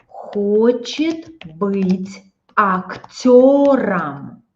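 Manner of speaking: хочет быть актёром stretched slowly, leaning on актёром; slow model sentence; slow teaching demo. Shown as a demonstration. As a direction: spell out актёром slowly for the class. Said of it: "You see? Instrumental case".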